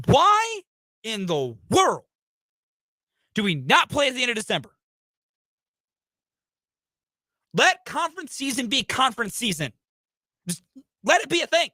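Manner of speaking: exasperated, pitch shooting up on the opening why; venting over a scheduling gripe; real frustrated rant. Real, not acted. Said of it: "I'm sorry for getting so angry".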